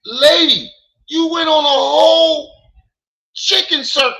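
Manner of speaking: high, loud, near-crying yell; heated shout. Real, not acted. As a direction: shout her down, voice breaking. Heated argument, shouting at her.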